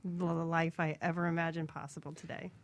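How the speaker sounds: normal talking voice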